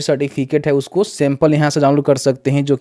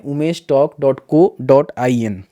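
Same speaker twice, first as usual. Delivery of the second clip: slow, careful dictation of a site name; slowed down so viewers can type Umesh talk dot co dot I N; slower than usual. Shown as a demonstration. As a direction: spell the web address out slowly, word by word. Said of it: "search करना है".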